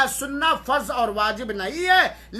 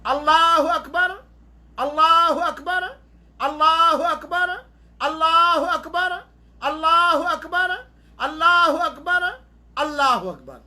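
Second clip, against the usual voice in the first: raised, louder ritual call, last one dropping; imam's takbir call; 6 semitones higher, 4 dB louder than usual. Shown as a demonstration. Teacher shows congregation the seven takbirs, called out like an imam. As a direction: call out each takbir evenly, with a pause between. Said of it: "सात तकबीर कहेंगे इमाम".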